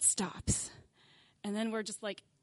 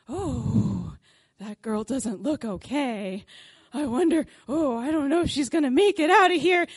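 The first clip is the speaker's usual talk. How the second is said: a hint of the worried tourist ladies; 2 semitones higher, 8 dB louder than usual. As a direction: gossip about the girl in a worried voice. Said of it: "the women turn to me and they go".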